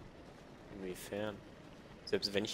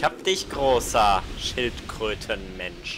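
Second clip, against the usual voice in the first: triumphant taunt; 11 dB louder, much slower than usual. Acted. Taunting defeated enemy.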